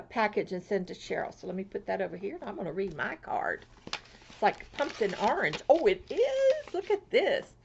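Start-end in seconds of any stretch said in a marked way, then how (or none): 5.7-7.5 delighted coo. Real, not acted. Admiring the orange card.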